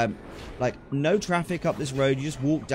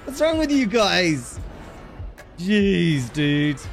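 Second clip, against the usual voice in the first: high-pitched, loud scolding; mock exasperation; 7 semitones higher, 3 dB louder, much slower than usual. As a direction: berate them in exaggerated disbelief. Mock outrage at jackets in heat.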